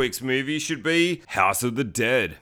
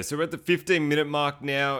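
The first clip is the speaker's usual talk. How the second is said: ordinary talk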